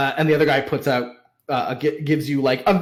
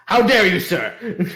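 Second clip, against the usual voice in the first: affronted merchant; 6 semitones higher than usual. Acted. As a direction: take grand offence at the accusation. Merchant accused of cheating.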